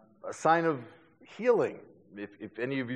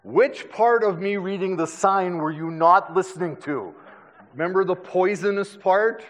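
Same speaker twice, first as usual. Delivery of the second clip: higher, louder incredulous questioning; exasperated dad; 5 semitones higher, 5 dB louder than usual. Retold or imitated. Dad to son who wants to pet a viper, exasperated. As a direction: scold in comic disbelief, punching the words.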